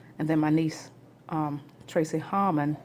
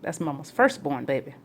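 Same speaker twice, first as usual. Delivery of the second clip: ordinary talk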